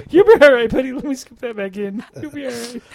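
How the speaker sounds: high, jokey comforting voice; mock reassurance. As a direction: play the hopeless medic cheering up a buddy. Mock patching up a headless soldier.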